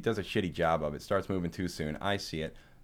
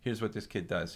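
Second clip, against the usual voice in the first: ordinary talk, nothing marked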